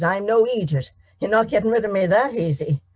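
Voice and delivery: defiant farmer. Acted. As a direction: dig in your heels and say it. Tom refuses to leave.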